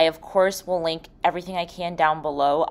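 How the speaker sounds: ordinary talk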